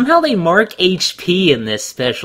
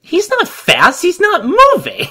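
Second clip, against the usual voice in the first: laughing disbelief. Real, not acted.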